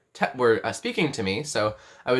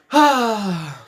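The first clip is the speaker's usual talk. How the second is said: long, loud high sigh; exasperated sigh; 8 semitones higher, 7 dB louder than usual. Real, not acted. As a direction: let out a big falling sigh. Exasperated after forgetting a word.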